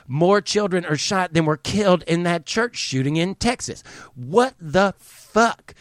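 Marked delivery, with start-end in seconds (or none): 4.2-5.8 slow, drawn-out stunned what the fuck; disbelief. Real, not acted. Ranting vlogger stunned by child shooting numbers, drawn-out disbelief. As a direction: let the disbelief stretch every word.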